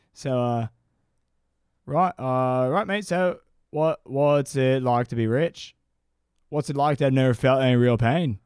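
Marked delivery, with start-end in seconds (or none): 1.9-8.4 passable mock Australian accent. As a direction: put on an Australian interviewer's voice. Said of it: "pretty good Australian accent".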